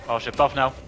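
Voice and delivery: urgent shout. Real, not acted. Gamer yelling to teammates for a buff mid-fight, urgent shout. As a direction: yell it to your team, it's urgent.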